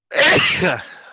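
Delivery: a sudden loud real sneeze; sneezing. Real, not acted. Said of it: "or shall I say I have sneezed".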